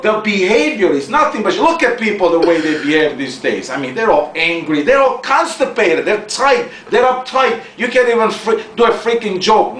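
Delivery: usual speaking voice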